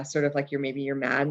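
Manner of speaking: ordinary delivery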